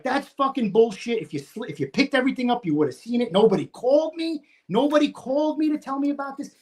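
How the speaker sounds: high, indignant retold objection; angry protest. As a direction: act out indignant disbelief, pitch climbing. Acted quarrel, rejecting their excuse.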